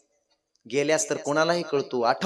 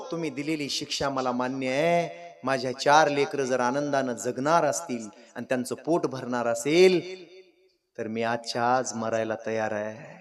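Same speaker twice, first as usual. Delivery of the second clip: resigned father; 4 semitones lower, 7 dB quieter than usual. Acted. Father accepts death for children.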